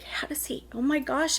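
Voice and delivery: barely voiced, breathless disbelief; stunned whisper. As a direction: whisper it as if afraid he'll hear. Stunned inner thought, whispered.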